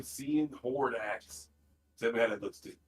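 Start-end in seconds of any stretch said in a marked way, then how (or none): 0.0-1.5 each word drawn out for typing; slow dictation. Shown as a demonstration. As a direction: spell it out slowly, word by word. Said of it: "Ted, type in".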